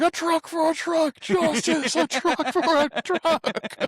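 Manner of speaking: very high, strained mock wail; high-pitched crazed chant, last phrase drawn out; hammed-up losing it. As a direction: cry it out like an unhinged villain. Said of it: "He loses it".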